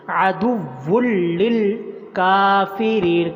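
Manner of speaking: very slow, chanted recitation; careful tajweed chant; slow Quran recitation. Shown as a demonstration. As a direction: draw out the doubled letters in the chant. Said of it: "کاف کو لمبا کرنا".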